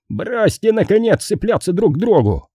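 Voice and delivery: high-pitched burst of irritation; exasperated outburst. A narrator's reading. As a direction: blow up at the bickering pair, voice leaping up. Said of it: "взорвался Джентри". (раздражённая вспышка, голос взлетает)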